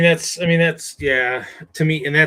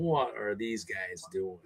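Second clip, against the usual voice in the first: puzzled murmur; 6 semitones lower, 10 dB quieter, much slower than usual. Retold or imitated. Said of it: "just going".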